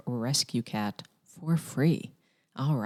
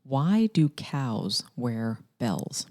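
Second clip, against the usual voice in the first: slow joke setup; 3 semitones lower, much slower than usual. Acted. Telling a dad joke.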